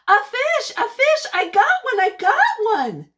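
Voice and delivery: high, loud, thrilled shout; excited yell. Acted. Child yelling to family about a caught fish, thrilled. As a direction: shout it like your first catch. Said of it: "I yelled".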